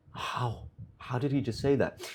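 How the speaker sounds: breathless whispered disbelief; hushed, barely voiced, stunned. Boy stunned by the swear, whispering in shock. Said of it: "he's just stunned".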